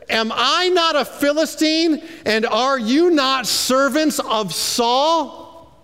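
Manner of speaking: mock the army from the hilltop; taunting Goliath. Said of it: "here's what Goliath says".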